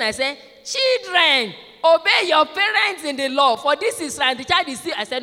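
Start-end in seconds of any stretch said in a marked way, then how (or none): 0.6-4.4 high, loud proclamation of the verse; emphatic scripture reading. Retold or imitated. Teacher rereading the passage to children, high loud proclamation. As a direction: read it out forcefully over an interrupting child. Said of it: "And I read it again".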